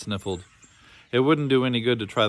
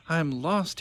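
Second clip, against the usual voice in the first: put-on a boy's high, rising wail; crying out lost; 8 semitones higher than usual. A narrator's reading. Little lost boy cries out to the empty maze. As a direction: call out frightened and small. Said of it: "he cried".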